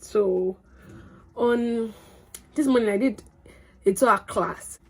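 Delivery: ordinary talk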